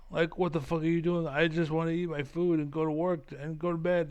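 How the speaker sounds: ordinary delivery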